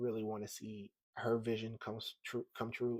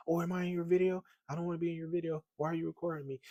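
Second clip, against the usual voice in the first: higher, louder imagined complaint; objecting bystanders; 7 semitones higher, 5 dB louder, much faster than usual. Retold or imitated. Imagined shop customers objecting to his camera, voiced in protest. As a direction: mimic strangers protesting at being filmed.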